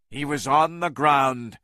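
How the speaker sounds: usual speaking voice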